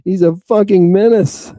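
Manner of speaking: loud, fast, high-pitched angry shout; retold yelling. Parents railing at Joseph about young Jesus, angry yelling. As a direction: imitate a furious crowd demanding he be removed. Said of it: "Everybody's yelling at Joseph".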